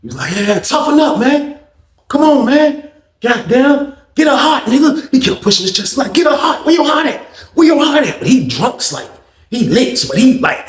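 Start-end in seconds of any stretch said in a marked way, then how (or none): 0.4-5.1 put-on high, laughing taunt of a drunk bully; mocking taunt. 6.1-8.2 put-on high, laughing taunt of a drunk bully; mocking taunt.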